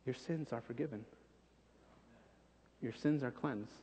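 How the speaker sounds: soft, gentle forgiving voice; tender reassurance. Retold or imitated. Angel reassuring Isaiah his sins are forgiven, softly tender. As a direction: comfort him quietly. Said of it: "he says".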